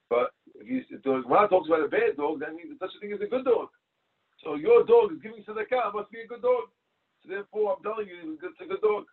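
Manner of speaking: high, rising eager pitch on "But if you" and "it's a good dog"; eager pleading. Retold or imitated. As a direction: talk him round with a bright eager pitch. Collector pleads his clever argument.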